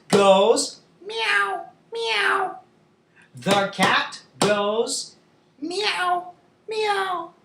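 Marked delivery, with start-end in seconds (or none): 1.0-2.6 high drawn-out mews; cat meow. Shown as a demonstration. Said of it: "talk about the sounds". 5.6-7.3 high, falling kitty mews; cat meow. Shown as a demonstration. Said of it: "talk about the sounds".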